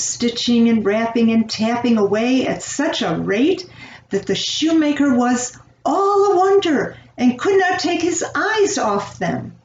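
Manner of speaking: ordinary delivery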